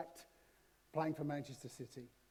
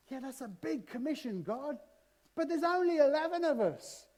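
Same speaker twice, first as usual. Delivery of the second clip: doubting disciples; 8 semitones higher, 4 dB louder than usual. Acted. Preacher voicing doubtful disciples, high and worried. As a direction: voice the nervous disciples' protest.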